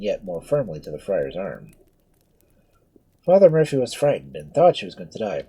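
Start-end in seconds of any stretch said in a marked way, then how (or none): none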